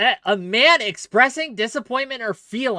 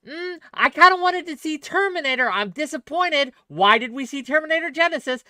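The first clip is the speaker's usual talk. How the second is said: whiny disappointment; 6 semitones higher than usual. Retold or imitated. Mock voice of a disappointed friend.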